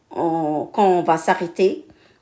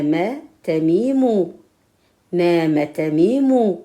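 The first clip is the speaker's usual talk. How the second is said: reading drill; 2 semitones higher, 4 dB louder, much slower than usual. Shown as a demonstration. Teacher reads drill sentences aloud for pupils. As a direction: read each syllable out for the class.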